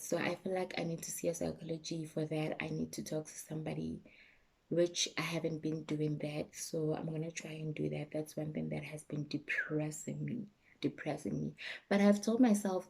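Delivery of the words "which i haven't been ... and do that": ordinary talk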